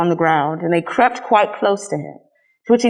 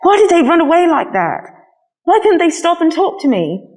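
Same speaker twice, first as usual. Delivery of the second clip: hurt wondering; 9 semitones higher than usual. A narrator's reading. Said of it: "he thought".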